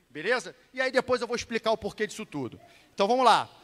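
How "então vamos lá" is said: usual speaking voice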